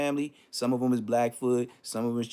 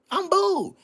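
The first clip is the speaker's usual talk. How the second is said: smug refusal; 5 dB louder than usual. Real, not acted. Refuses church.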